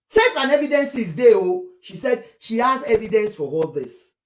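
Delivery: ordinary talk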